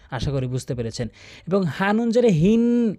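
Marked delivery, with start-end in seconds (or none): 1.5-2.9 হিন stretched with ghunna; slow tajweed ghunna demo; held nasal hin. Shown as a demonstration. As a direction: hum হিন through the nose, held long. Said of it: "এই যে আমি লম্বা টান দিয়ে ধরেছি".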